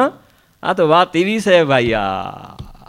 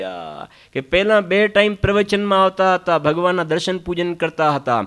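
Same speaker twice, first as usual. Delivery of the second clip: ordinary delivery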